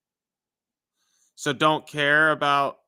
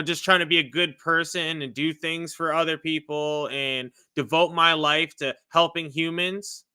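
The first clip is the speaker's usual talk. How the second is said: ordinary delivery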